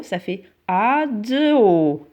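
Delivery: ado stretched out very slowly, syllable by syllable; slow, drawn-out reading. Shown as a demonstration. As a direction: read ado slowly and clearly for children. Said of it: "A D O".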